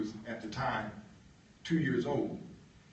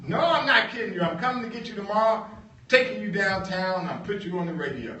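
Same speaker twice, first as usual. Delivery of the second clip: higher, louder, quick insistence; insistent boss; 8 semitones higher, 8 dB louder, faster than usual. Retold or imitated. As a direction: push him along, brushing off his doubt. Boss insisting, voiced high.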